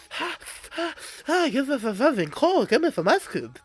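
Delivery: high, panting burnt-mouth talk; scalded tongue. Retold or imitated. Scalded onlookers begging for something cold, mock burnt tongue. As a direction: pant and talk round a burning tongue, hammed-up. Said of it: "My tongue is on fire".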